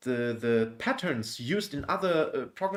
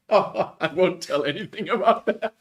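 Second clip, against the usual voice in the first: louder, higher, through laughter; laughing; 7 semitones higher, 8 dB louder than usual. Real, not acted. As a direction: laughingly refuse to tell. Laughing, refusing to tell.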